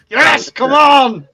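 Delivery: loud high come on; cheering. Real, not acted. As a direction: cheer the viewers on. Cheering on poll voters.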